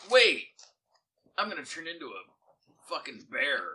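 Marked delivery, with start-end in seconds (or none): 0.1-0.5 shout. Real, not acted. Player suddenly calls out.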